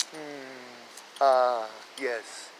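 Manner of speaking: soft, hushed contented hums; a blissful monk ignoring the chaos; lightly voiced peaceful Buddha; serene Buddha. Buddha serene amid bombs.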